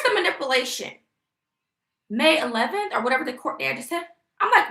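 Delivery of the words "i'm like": usual speaking voice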